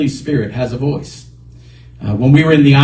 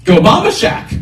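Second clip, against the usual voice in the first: arguing with himself; 8 semitones higher than usual. Retold or imitated. Driver resisting the voice, arguing with himself. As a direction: insist on the bar over church. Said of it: "I had an argument with myself".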